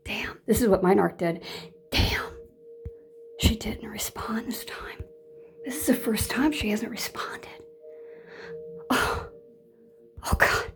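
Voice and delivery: hushed, worried narc muttering; panicked narc whisper. Retold or imitated. Voicing a narc noticing the victim stopped responding, whispering. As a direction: whisper like a narc losing supply. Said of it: "They're like".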